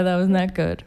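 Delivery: ordinary talk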